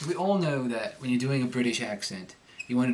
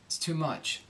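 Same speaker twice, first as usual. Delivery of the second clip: normal talking voice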